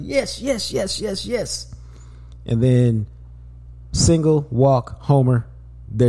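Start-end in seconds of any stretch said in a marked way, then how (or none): 0.0-1.8 high-pitched, softer cheering yeses; mock cheering fans; hyped fan cheer. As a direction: imitate excited fans chanting yes. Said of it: "Everybody's like".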